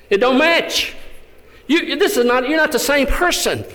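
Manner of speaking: a judge who can't believe his file; amazed judge. Retold or imitated. Judge telling Jane her file doesn't match her, astonished.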